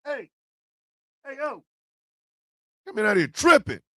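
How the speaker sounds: usual speaking voice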